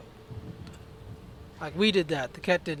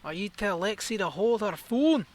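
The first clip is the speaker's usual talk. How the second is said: mock Scottish accent, slightly higher; a clumsy attempt at a Scottish brogue; bad Scottish accent; 4 semitones higher, 3 dB quieter than usual. Player talking back to the game's crew, in a bad Scottish accent. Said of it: "worst Scottish accent I've ever even tried".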